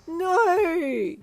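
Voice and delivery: high loud comic wail. Acted. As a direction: wail after him like a zombie. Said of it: "you wailed".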